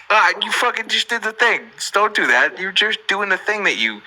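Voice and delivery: high, exasperated mock scolding. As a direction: scold someone who just did the annoying thing, half joking. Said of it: "I'm just like".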